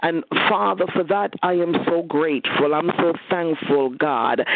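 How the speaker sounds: rising, impassioned prayer voice building toward a climax; fervent prayer. Real, not acted. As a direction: church prayer leader pouring out thanks.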